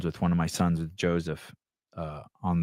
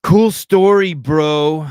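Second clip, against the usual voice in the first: much higher and louder, hammed-up mocking; sneering high-pitched jock voice; sarcastic mock reading; 9 semitones higher, 10 dB louder, much slower than usual. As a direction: read it loud and high as a taunt. Reading a sarcastic comment aloud.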